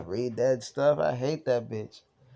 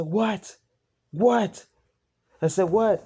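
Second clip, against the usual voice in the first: retold demanding shout; 7 semitones higher, much slower than usual. Confronting the roommate after a scare, high demanding shouts. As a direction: bark it at him, rattled.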